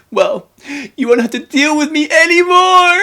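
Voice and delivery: very high, loud hammed-up strop; mock toddler tantrum. Max storming off into the water, mock toddler tantrum. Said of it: "That's such a toddler reaction".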